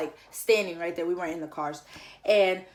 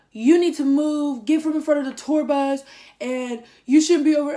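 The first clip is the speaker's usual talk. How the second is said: loud, higher shouting order, slowed; yelling; 7 semitones higher, 9 dB louder than usual. Retold or imitated. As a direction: order them away from the tour bus, yelling. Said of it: "Who are you yelling at?".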